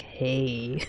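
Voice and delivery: low, soft smug "hey". Retold or imitated. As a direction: let a smug hey slide out quietly. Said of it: "It is pretty smug".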